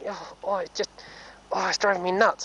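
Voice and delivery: high fretful whine, voicing the pony; voicing the horse. Rider voicing her itchy horse, high fretful whine.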